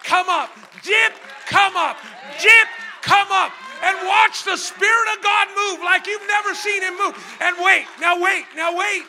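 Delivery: loud, high shouted sermon climax; preaching at full force. Acted. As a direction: drive it home to the congregation.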